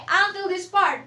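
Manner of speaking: ordinary talk, nothing marked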